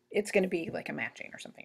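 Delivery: ordinary delivery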